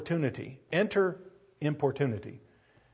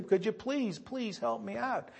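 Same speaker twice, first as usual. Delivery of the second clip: soft begging; 6 semitones higher, 8 dB quieter than usual. Acted. Friend begging softly.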